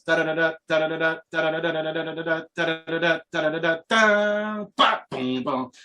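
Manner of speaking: rapid "da-da-da" bursts ending in booms; vocalised drum riff. Retold or imitated. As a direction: drum it out by mouth, stretch the last roll. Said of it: "Now the new one goes".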